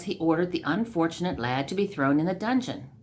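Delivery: usual speaking voice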